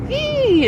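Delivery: high piercing eagle screech. Shown as a demonstration. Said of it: "it says".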